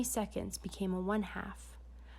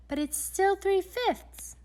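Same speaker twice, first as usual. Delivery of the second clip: high, slow lady's voice; lightly voiced lady, drawn out; Madame de Géométrique; 12 semitones higher, 5 dB louder, slower than usual. A narrator's reading. Madame agrees with the detective, high and slow.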